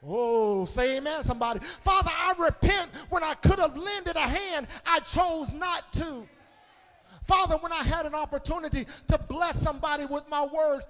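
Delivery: loud, high hollering sermon; fiery climax of the sermon; preaching at full force. Acted.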